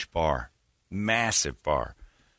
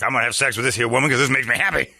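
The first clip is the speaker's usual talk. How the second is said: fast, raised put-on man's voice; mock blunt declaration; 5 semitones higher, 3 dB louder, faster than usual. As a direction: rattle it off as his brazen announcement. Imitating the blunt man.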